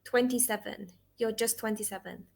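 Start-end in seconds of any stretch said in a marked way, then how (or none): none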